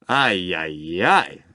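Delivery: mock dismay rising on the last ay; a theatrical tut-tutting cry; hammed-up ay ay ay; exclaiming. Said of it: "exclaimed the artist".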